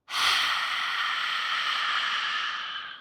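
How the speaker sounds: very long, slow voiceless exhale; drawn-out airy release; deep sigh out. Shown as a demonstration. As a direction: sigh out steadily until the breath is gone. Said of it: "Then with a deep sigh out".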